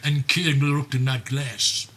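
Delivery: plain reading voice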